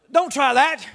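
ordinary talk

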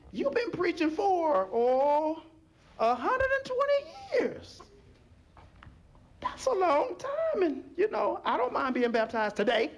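very high pleading, stretched out then easing down; mock pleading. A latecomer begs Noah to let them in, mock pleading. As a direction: ham up a high, wheedling appeal. Acted last-minute plea to Noah.

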